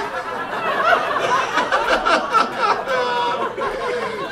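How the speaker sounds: high, loud, hammed-up sobbing; mock crying. Husband crying alone in the bathroom, mock sobbing. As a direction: bawl in exaggerated falsetto wails. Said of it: "I would just cry while doing".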